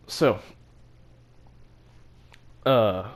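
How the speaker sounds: usual speaking voice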